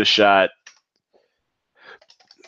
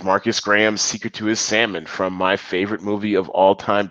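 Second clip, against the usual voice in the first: ordinary talk, nothing marked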